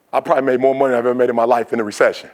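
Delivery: fast, understated aside; offhand remark. Real, not acted. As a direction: rattle it off in passing. First take, said in passing.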